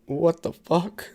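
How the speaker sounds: a slow, drawn-out baffled what the fuck. Retold or imitated. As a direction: stretch it out in disbelief. Bewildered at the runaway.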